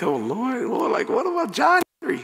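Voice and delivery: high, pleading mock prayer; playful high-pitched plea; mock plea to God. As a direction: cry out to the Lord in a squeaky voice. Mock prayer about new son.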